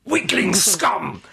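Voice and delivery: slow, drawn-out theatrical insult; hammed-up insult. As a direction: relish each word like a melodramatic villain. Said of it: "the characters start insulting each other".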